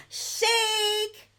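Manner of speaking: high, stretched-out word after a gasp; sustained, high-pitched announcement; big reveal. Pretend. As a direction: unveil it with showy excitement.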